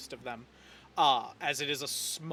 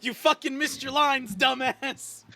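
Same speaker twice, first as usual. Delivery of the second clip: higher, louder bratty jab; mock scolding; 8 semitones higher, 5 dB louder than usual. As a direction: tease a fellow actor. NPC kid scolding another kid.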